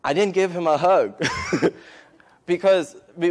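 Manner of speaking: laughing. Real, not acted. Laughing at his own refusal.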